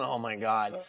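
mock awkward mumble. Imitating a stalled improviser, mumbled. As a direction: mumble it like you're stuck onstage. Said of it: "just like going".

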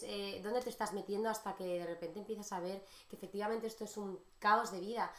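ordinary talk, nothing marked

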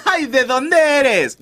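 high, slow, breathless swoon; hammed-up smitten sigh; swooning girl. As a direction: melt like a girl charmed. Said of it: "Y la morra".

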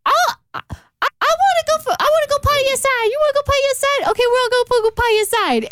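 high, playful pet voice; dog voice. Retold or imitated. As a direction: voice the dog like a baby. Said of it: "we do this thing called the dog voice".

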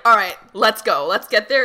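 loud and driven, on a mission; determined loud quote. Retold or imitated. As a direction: bark the go-ahead like a leader. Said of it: "She's like".

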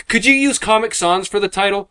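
high-pitched clueless customer; mocking high-voiced request; mock client voice. Mimics clueless design clients.

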